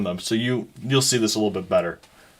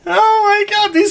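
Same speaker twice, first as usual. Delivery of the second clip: high squeal; 3 dB louder than usual. Real, not acted. Table reacting to drunk guards.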